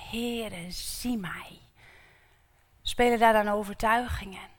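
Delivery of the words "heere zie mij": soft, breathy whisper of a plea; whispered prayer. Acted. People calling to the Lord, whispered plea. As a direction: pray it under your breath.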